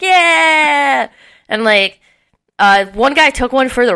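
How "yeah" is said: excited yeah. Retold or imitated. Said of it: "and I'm like".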